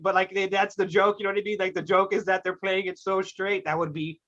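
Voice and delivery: normal talking voice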